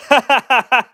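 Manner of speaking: loud, high burst of laughter; real laugh at a funny jab; amused laugh. Real, not acted. Host cracking up at a viewer's joke, amused laugh.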